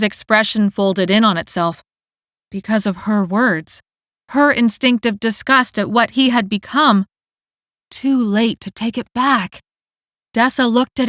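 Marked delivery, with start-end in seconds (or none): none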